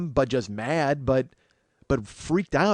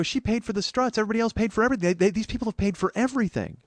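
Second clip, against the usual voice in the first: high-pitched, insistent retelling of an argument; worked-up protest; 9 semitones higher, faster than usual. Retold or imitated. Man explaining to a friend who paid, worked-up protest.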